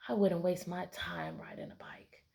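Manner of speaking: a sneering whisper; the cat thinks about the duck; whispered scornful thought. Acted. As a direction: hush it like a lazy cat's secret.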